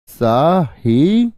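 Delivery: Sahi stretched very slowly with a rising end; drawn-out demo. Shown as a demonstration. Teacher drags out Sahi for the pupils, very slow. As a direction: hold Sahi long so they hear each sound. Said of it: "देख लो ध्यान से".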